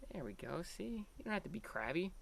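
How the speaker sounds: soft, calming words to an animal; hushed coaxing to a snake; soothing. Real, not acted. Soothing the snake in his hand.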